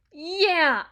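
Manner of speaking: high, loud burst of anger; sudden heated exclamation; angry outburst. Acted. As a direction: snap it out loudly. Anger breaking out.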